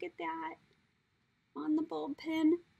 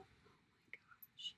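barely voiced, hushed awe; soft whisper; 22 dB quieter, faster than usual. Real, not acted. Unboxing gifts from Tiffany, hushed whisper. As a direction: whisper it like a secret.

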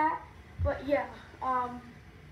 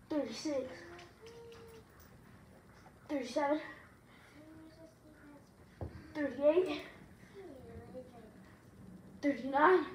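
pushing out the last reps; straining through sit-ups. Real, not acted.

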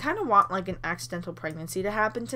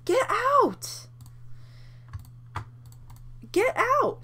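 shrieking order; much slower than usual. Real, not acted. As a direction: shriek them out of the room. Shouting at Sim intruder.

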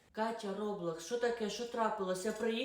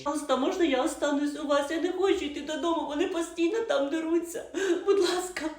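put-on much higher, begging teen voice; runaway girl begging the neighbour to let her stay; pleading girl; 8 semitones higher, 3 dB louder than usual. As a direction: beg like a scared child.

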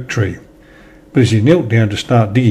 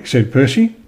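ordinary delivery

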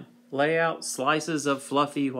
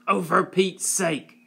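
higher, louder spat retort; 4 semitones higher, 3 dB louder than usual. A narrator's reading. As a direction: spit the words out in contempt. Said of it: "his aunt spat".

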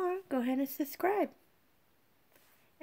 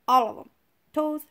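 usual speaking voice

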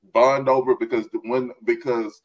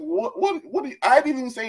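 much higher, squeaky mock innocence; mock-innocent what what what, much higher; faster than usual. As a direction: play dumb in a high squeak. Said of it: "I'm like".

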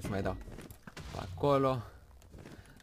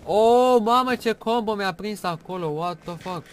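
loud, high startled cry at a combo hit; shocked outburst; 9 semitones higher, 11 dB louder, slower than usual. Real, not acted. Gamer yelling at the dragon's combo, shocked and loud. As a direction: blurt it out in shock, much higher and louder.

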